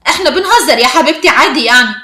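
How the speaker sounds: loud, mocking brush-off; sarcastic. A narrator's reading. Girl brushes off the friend's anger, sarcastic and loud. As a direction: dismiss the complaint with open sarcasm. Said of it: "البنت بسخرية".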